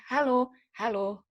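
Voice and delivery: demonstrating different melodies on "Halo"; varied intonation. Shown as a demonstration. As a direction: stretch each "Halo" slowly on a different tune. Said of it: "bisa dapet banyak banget intonasi".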